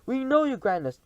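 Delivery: high, loud meek reply; fawning chorus. Acted. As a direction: answer humbly. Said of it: "they all answered".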